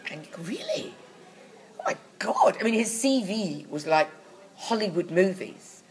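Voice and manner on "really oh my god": much higher, soft incredulous reaction; mock amazement. Listener hearing of a Hollywood credit, amazed. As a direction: gush in disbelief, high and hushed.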